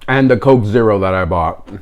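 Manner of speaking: normal talking voice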